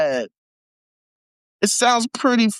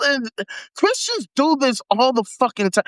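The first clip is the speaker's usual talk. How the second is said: ordinary talk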